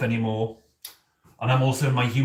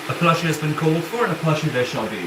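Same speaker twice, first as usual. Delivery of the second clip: grand return; 4 semitones higher than usual. Acted. Returns, grandly announces plushie.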